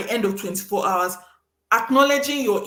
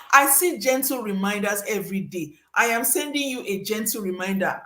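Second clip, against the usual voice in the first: ordinary talk, nothing marked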